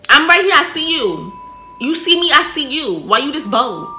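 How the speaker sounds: loud high taunting voice; bold demon taunt. Retold or imitated. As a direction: taunt loudly and boldly.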